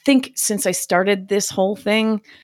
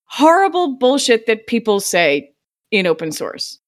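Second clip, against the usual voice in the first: ordinary talk, nothing marked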